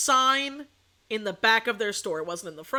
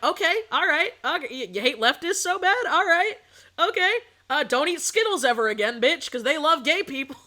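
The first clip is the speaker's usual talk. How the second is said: raised, punchy sarcastic retort; mock combative comeback; 4 semitones higher, 4 dB louder than usual. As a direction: throw their logic back at them mockingly. Mock comeback to right-leaning friends.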